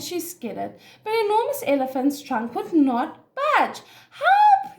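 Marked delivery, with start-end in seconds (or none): 4.1-4.8 loud, very high-pitched call; yell for help. A narrator's reading. Elephant yells with trunk still stuck, high cry. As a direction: shriek it out like a trapped animal. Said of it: "yelled the Enormous Elephant".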